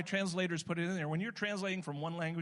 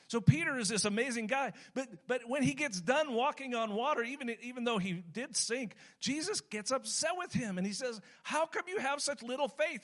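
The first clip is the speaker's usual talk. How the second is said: higher and louder, building sermon fervor; impassioned preaching; 8 semitones higher, 4 dB louder than usual. Acted. Pastor working up his church about Peter, fervent preaching. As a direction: preach it up to the congregation.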